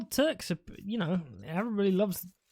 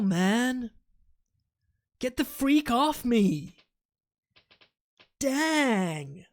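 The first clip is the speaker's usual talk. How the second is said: exaggerated American accent, a bit higher; 3 semitones higher, slower than usual. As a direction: stretch the words in a mock-American accent. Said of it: "my American accent isn't so well practiced".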